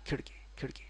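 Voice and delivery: khidki whispered with a hard d; whispered wrong khidki. Shown as a demonstration. Teacher whispers the wrong khidki. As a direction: khidki breathed out, plain d. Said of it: "It's not khidki".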